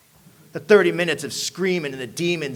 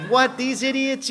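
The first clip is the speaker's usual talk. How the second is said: higher, slow disbelieving scoff; 9 semitones higher, 4 dB louder, slower than usual. Retold or imitated. Young addict mocking the AA visitors, scoffing. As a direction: scoff at them.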